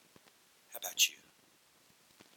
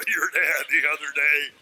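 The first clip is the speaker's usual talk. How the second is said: teasing schoolkid; 6 semitones higher, 10 dB louder, slower than usual. Acted.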